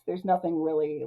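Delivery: ordinary delivery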